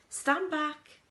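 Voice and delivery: high, loud stand back; sharp warning. A narrator's reading. Wonka warning the group to stand back, loud. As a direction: warn them off the machine.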